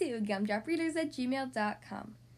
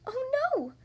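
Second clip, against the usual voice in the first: very high, startled "oh no"; storybook shock, pitched way up; dismayed gasp; 12 semitones higher, 4 dB louder than usual. A narrator's reading. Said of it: "Goldilocks gasped".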